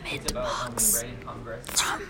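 soft, voiceless whisper into the mic; hushed playful ASMR talk. Pretend. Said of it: "I'm gonna make an A S M R with my nails".

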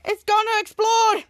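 high, slow alarmed wail; a hint of hammed-up panic; panicked. [name] warning the machine will blow, panicked. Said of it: "[name] going into panic".

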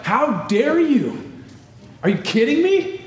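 high-pitched, incredulous outrage; indignant Paul. Acted. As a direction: act out shocked, righteous anger. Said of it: "Paul is blown away here".

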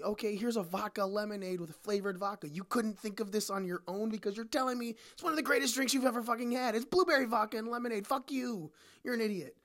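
fed-up bartender venting; ranting. Real, not acted. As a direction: vent at the customers. Rant continues.

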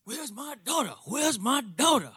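hushed, softly voiced impersonation of a champion boxer; Muhammad Ali. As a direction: imitate the champ asking softly for his child. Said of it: "He's like this".

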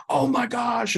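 high, loud panicked gasp of alarm; a dad losing it over his hurt child. Pretend. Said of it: "and just go absolutely crazy".

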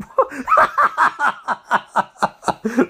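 long rising amused laugh; real laughter. Real, not acted. Watching Loomis in the action scene, real laughter. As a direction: let the laugh roll on.

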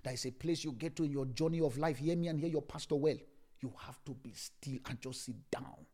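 ordinary talk, nothing marked

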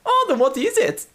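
high, curious voice; Klaus voice. Acted.

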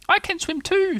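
very high little voice, boasting; proud Roo. A narrator's reading. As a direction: boast in a tiny squeal. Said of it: "said Roo proudly".

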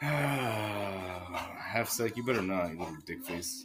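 low, soft grumble; muttered warning. Real, not acted. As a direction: mutter a threat under a sigh. Muttered warning after sigh.

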